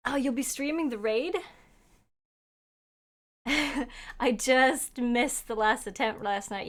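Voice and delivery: usual speaking voice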